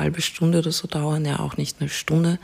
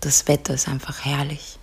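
soft spa whisper; 5 dB quieter than usual. Acted. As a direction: whisper calmly, guide them to relax. Leads a guided relaxation.

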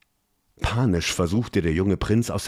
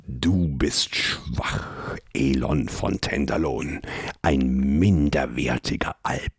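menacing creature; 4 semitones lower than usual. Acted. Creature threatening the choking prince, low whispered menace.

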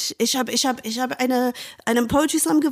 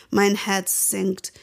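ordinary talk, nothing marked

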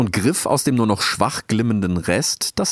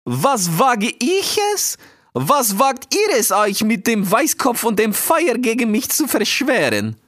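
high, slow indignant retort; outraged sea eagle; 13 semitones higher, slower than usual. A narrator's reading. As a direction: throw the accusation back, drawn out and high. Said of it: "antwortete Schwager Iwan". (empörter Seeadler, hoch und gedehnt)

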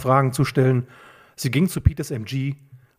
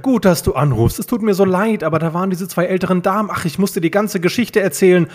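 high, gushing excuse; flustered apology; 6 semitones higher, 3 dB louder than usual. A narrator's reading. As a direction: apologise eagerly in a raised pitch. Peter gushes apologies to friend.

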